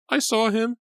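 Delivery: high, loud terrified cry. A narrator's reading. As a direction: cry it out, teeth chattering. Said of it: "she cried, her teeth chattering".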